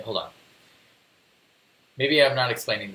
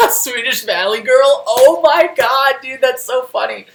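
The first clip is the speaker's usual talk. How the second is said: high, loud laughing exclamation; helpless high-pitched amusement, loud; cracking up; 11 semitones higher, 10 dB louder than usual. Real, not acted. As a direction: talk through real, delighted laughter. Cracking up at a chat comment.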